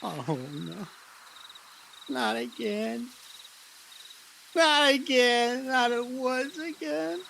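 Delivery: slow, high, frightened pleading; scared and lost. Acted. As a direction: dread of being lost in the woods again. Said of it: "really scared that you're lost in the woods".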